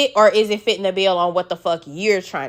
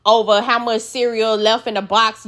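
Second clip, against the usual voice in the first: ordinary talk, nothing marked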